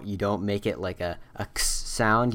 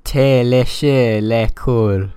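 bad French accent; 3 semitones higher, 12 dB louder, slower than usual. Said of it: "probably terrible pronunciation".

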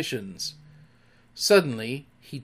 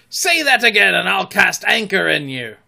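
high, loud pirate threat; blustering captain's shout; threatening retort; 7 semitones higher, 10 dB louder than usual. A narrator's reading. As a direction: roar it as a warning. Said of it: "the voice retorted".

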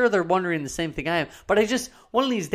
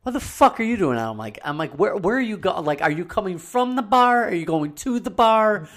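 incredulous; 5 semitones higher, 4 dB louder than usual. Retold or imitated. Retold reaction to night drivers.

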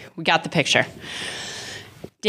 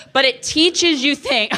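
ordinary talk

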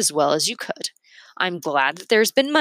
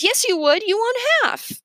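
whiny accusing voice. A narrator's reading. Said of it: "she says".

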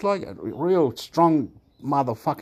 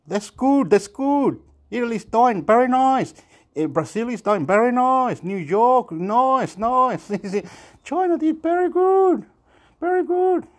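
mock-cheery praise, rising higher and higher; sarcastic praise; 9 semitones higher than usual. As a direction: gush over it with mocking high praise. Sarcastic praise, pitch climbs high.

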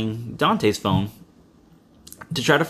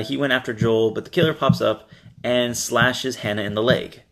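usual speaking voice

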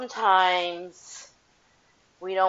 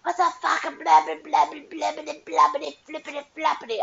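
mocking angry friend; 9 semitones higher than usual. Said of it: "if they're like".

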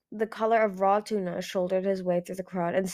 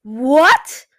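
very loud, rising, outraged roar; furious roar; 5 semitones higher, 15 dB louder than usual. A narrator's reading. Skywing swells with fury at Ice Wing, roaring. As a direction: roar it in disbelief. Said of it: "he roared".

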